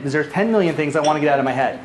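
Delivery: ordinary delivery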